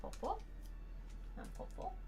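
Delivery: soft, high cooing to the dog; gentle sing-song dog talk; pet talk. Real, not acted.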